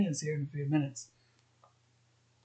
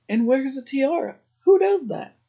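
can't believe anyone wears that to dinner; incredulous; 8 semitones higher, 3 dB louder than usual. Real, not acted. Vlogger baffled by an extra in a tiara, incredulous. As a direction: pitch jumps way up on who does that, louder at the end.

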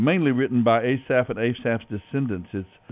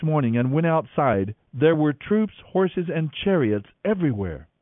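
scripture reading; 4 semitones higher than usual. Acted. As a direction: read the passage like an audio Bible narrator. Reads Bible passage over music.

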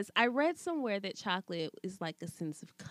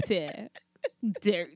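usual speaking voice

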